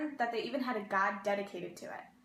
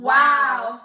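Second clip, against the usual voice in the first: loud wow; 16 dB louder than usual. Acted. Echoing wow loudly.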